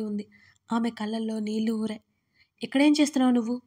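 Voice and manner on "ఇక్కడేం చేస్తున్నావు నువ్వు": fast, loud scolding shout. A narrator's reading. Husband shouting at his wife by the railing, fast and loud. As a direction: fire it at her in one rushed, loud burst. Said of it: "అతను గట్టిగా అరుస్తూ".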